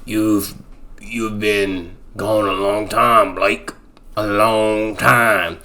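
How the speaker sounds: low, slow, quiet menacing drawl; creepy ranger's son. Retold or imitated. Ranger's son to Blake, slow eerie quiet voice. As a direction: stretch it out slow and low, unsettling.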